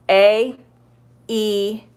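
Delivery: A and E drawn out as letter names; long vowels. Shown as a demonstration. Teacher showing learners that long vowels say their name, long vowels. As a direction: say A and E as long vowels, each held alone. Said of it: "they say their name".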